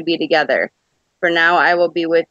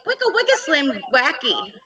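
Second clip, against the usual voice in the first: DJ scratch imitation; 9 semitones higher than usual. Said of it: "it'd be like".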